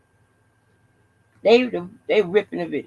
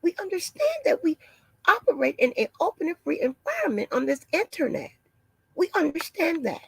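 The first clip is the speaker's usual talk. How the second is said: mock-alarmed office lady, pitched way up; 10 semitones higher than usual.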